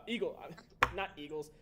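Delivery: ordinary delivery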